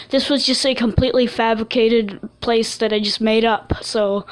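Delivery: ordinary talk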